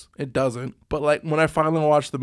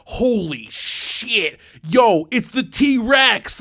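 slow, drawn-out awed exclaim, louder and higher at the end; imitating freaking out; 6 semitones higher, 4 dB louder, much slower than usual. Older brother spotting the T-Rex at the ride, slow awed yell. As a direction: play the big brother losing it over the T-Rex. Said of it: "he was freaking out".

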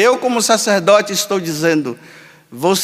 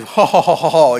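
lightly voiced lower genie chuckle; genie laugh; 5 semitones lower than usual. Priest recalling the Shazam cartoon, genie laughing. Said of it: "e falava assim".

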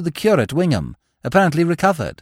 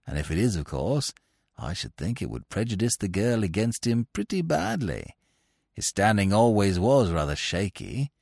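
lower, quieter, musing scheming voice; quiet, lower sly rival thinking aloud; meditative; 6 semitones lower, 5 dB quieter than usual. A narrator's reading. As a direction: muse it over low, a schemer plotting. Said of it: "said Steggles meditatively".

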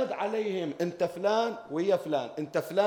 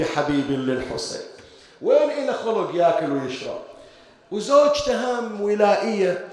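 louder preaching storytelling; emotional preaching; 5 dB louder than usual. Acted. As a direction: push the grief onto the mourners. (سرد خطابي حسيني مرتفع)

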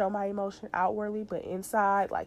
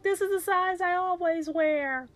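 high voice; 11 semitones higher than usual. Retold or imitated. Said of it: "I'm like".

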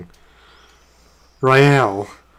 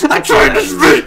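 laughing; 13 semitones higher, 5 dB louder than usual. Real, not acted. Laughing protest at interruptions.

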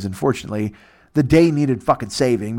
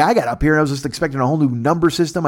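normal talking voice

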